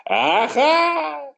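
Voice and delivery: put-on high, nasal-free old man "Aha!". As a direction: play a chuckling old grandfather.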